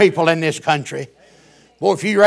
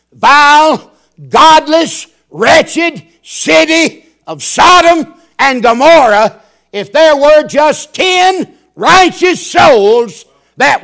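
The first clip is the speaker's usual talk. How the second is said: slow, loud, high preacher's cry; fiery preaching; 4 semitones higher, 8 dB louder, slower than usual. Acted. Preacher crying out to his flock about wicked Sodom, slow and loud. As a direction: thunder each word at the congregation.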